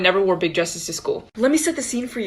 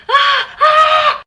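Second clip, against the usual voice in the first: screams; 6 dB louder than usual. Retold or imitated. Spider freak-out scream.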